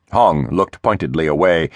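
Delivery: plain reading voice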